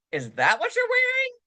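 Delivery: shocked disbelief. Shown as a demonstration. Friend judging an outfit, high shocked disbelief. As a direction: say it aghast at the outfit.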